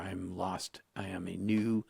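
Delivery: normal talking voice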